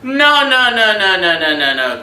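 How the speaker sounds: rapid no's, high and loud, dropping; emphatic denial. Real, not acted. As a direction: wave it off fast. Comic denial.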